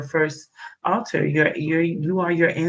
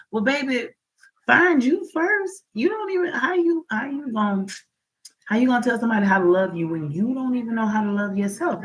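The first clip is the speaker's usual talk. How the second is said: sassy; 6 semitones higher than usual. Acted. Sassy advice to viewers.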